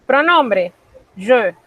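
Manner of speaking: je with a closed, tight vowel; closed French je. Shown as a demonstration. Teacher demonstrating the pronoun je, closed vowel. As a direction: demo the closed sound of je. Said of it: "suena más o menos así".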